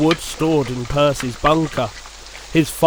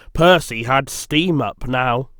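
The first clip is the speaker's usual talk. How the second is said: usual speaking voice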